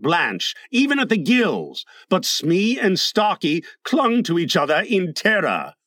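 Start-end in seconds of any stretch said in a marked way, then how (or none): none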